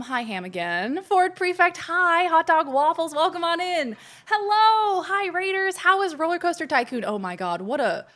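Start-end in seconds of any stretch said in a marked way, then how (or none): 1.0-7.0 high-pitched, eager hellos; excited greeting. Real, not acted. Streamer greeting raiders by name, high and excited. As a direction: welcome each newcomer high and loud with delight.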